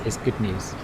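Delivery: normal talking voice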